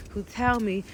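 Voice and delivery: ordinary talk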